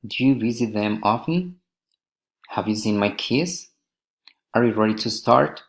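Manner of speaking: put-on "often", "kids" and "start" lifted at the end of each question; rising intonation. Shown as a demonstration. Teacher models general questions, rising on "often", "kids", "start". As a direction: let the pitch climb on "often", "kids", "start". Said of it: "rising intonation".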